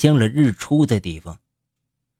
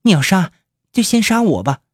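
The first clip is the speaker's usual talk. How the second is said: defiant, higher; resolute; 9 semitones higher than usual. Acted. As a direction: stand firm, unafraid. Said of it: "坚定地守在司徒长江面前".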